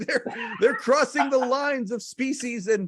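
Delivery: mock-sentimental voice laughing at himself; mock gushing. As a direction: gush over the horse and puppy like a teary fan. Voicing his gushing inner reaction.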